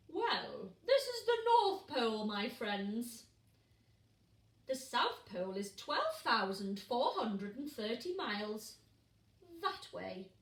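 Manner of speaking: proud booming bear. Acted. Bear corrects lost penguins.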